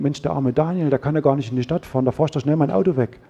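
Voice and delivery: quiet, quickened sympathetic thought; softened, sympathetic. Acted. Preacher voicing the wife's melting thoughts about him, softly sympathetic. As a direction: soften into a pitying aside to oneself. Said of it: "wird Renate ganz weich und denkt".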